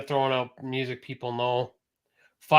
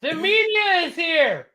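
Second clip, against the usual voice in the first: very high, slow cartoonish alarm; mock panic; 12 semitones higher, 4 dB louder, slower than usual. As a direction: draw out a hammed-up warning up high.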